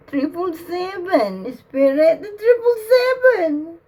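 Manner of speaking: tearful plea to the spirit; crying out. Real, not acted.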